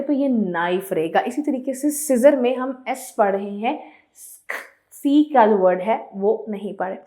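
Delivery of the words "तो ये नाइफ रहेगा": usual speaking voice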